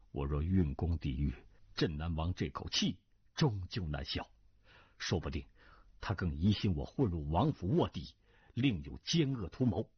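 low, guarded private reasoning; schemer's inner reckoning. Acted. Cui reckoning how the prince would take resistance, low thought.

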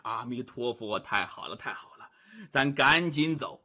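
low, soft murmuring monk; relieved Tang monk. Acted. Tang Seng rejoices quietly at the escape, relieved low murmur. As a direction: murmur thanks under the breath.

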